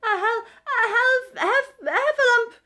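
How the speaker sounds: high, loud, slow frightened stammer; breathless panic. A narrator's reading. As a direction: blurt it out gasping and scared. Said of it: "breathing so hard that he could hardly speak".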